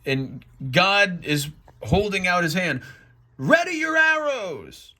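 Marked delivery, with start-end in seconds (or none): 3.4-4.9 high, stretched battle order; commanding God. Acted. God holding out his hand, commanding call.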